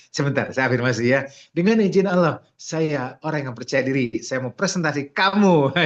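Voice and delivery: high, loud, hammed-up self-affirmation in front of someone; mock loud affirmation. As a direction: announce it loudly to their face. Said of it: "Jangan di depan orangnya". (afirmasi keras yang dibuat-buat)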